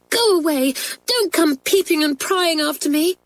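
high, tearful rebuff; sobbing. Acted. Crying girl shakes off her friends, sobbing. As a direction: send them away through acted tears.

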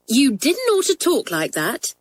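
high, mincing schoolgirl voice. A narrator's reading. Sheila reproving an angry classmate in a mincing voice. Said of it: "began Sheila in a mincing voice".

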